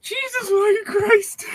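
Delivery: very high, slow laugh-cry exclamation; laughing squeal. Real, not acted.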